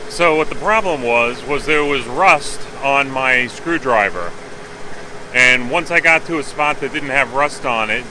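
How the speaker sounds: loud, pushing over engine noise; talking over engine. Real, not acted. Loud engine running nearby.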